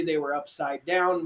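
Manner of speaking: ordinary talk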